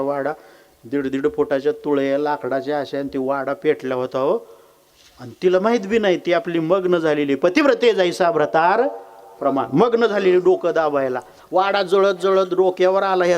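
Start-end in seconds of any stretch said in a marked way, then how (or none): none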